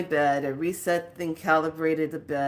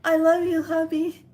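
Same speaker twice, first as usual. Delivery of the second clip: high, louder, pleading goodbye; tearful farewell; 11 semitones higher, 3 dB louder than usual. Retold or imitated. Mom bidding her husband farewell, high and tearful. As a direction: say goodbye to your husband like it's the last time. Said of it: "she's saying it out loud".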